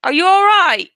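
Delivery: high, loud mock British "are you all right"; mock British accent. Teasing the chat about her husband, in a loud mock British accent. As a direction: play the husband gone British, hamming the accent. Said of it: "my husband's gone British".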